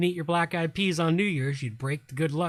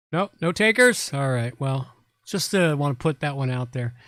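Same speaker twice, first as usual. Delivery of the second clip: show host address; 4 semitones higher, 5 dB louder than usual. Acted. Addresses stream audience as host.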